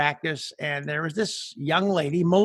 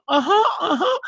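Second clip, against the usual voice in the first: high tearful agreement, imitated; whimpering student's eager yes, hammed up; mock crying student; 11 semitones higher, 4 dB louder than usual. Crying student asked if she wants band back, mock high tearful assent. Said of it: "And she starts crying".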